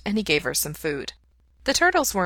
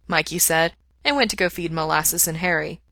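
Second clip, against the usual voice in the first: usual speaking voice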